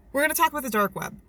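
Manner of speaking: ordinary delivery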